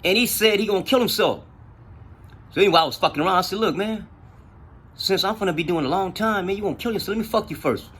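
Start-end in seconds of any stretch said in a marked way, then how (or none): 3.6-7.9 high, lightly mock-earnest proposition; retold teasing in a raised pitch; joking. Speaker messing with a jail acquaintance, joking. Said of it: "I'm just fucking around with him".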